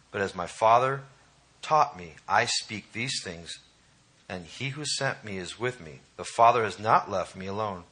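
hushed reverent reading; scripture reading. Acted. Reads Jesus' words aloud.

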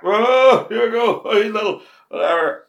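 high, teasing bar banter; mock teasing. Pub friends razzing him over the phone call, teasing. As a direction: rib him like the lads at the bar.

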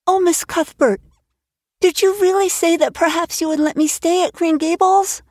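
put-on a girl barely daring to hope; breathless girl. Anne begging Marilla to let her stay, breathless and high. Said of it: "she said in a breathless whisper".

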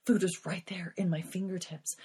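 soft unvoiced confession of temptation; hushed admission of temptation; whisper. Real, not acted. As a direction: breathe it like a secret.

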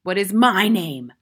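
loud, stern interrogation; domineering schoolmistress; tyrannical headmistress, demanding. A narrator's reading. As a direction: demand it sharply of the boy.

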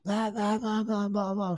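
imitating his own whiny rant; mock whining. Mocking himself whining to his sponsor on the phone. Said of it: "fucking the bitching and moaning".

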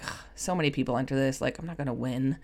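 low, soft, dismissive sigh of giving up; defeatist grumble. Retold or imitated. Someone at a contest receipt, low and dismissive. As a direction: mutter it like it's pointless. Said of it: "it's not from, like".

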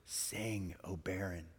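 soft, tender voicing of the verse; hushed scripture reading. Wife reading the first verse aloud, hushed. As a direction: read it quietly, letting each word land. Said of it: "she begins to read the very first verse".

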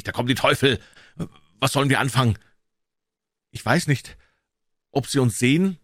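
put-on scared boy at a loss; panicked. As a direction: panic rising as something approaches. Boys fear devils are coming.

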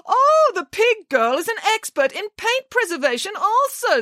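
very high, mock-amazed director; theatrical, scornful false admiration; sarcastic. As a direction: gush with biting sarcasm. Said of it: "said the director sarcastically".

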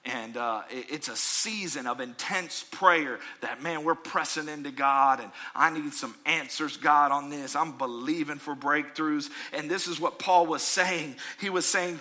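ordinary talk

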